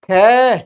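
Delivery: खे loud, with a held vowel; drawn-out syllable. Shown as a demonstration. Teacher testing pupils on the end sound, खे drawn out. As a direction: push out खे and hold the end. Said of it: "किसकी ध्वनि आ रही है".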